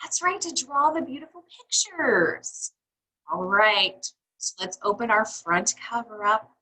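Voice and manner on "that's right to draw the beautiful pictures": ordinary delivery, nothing marked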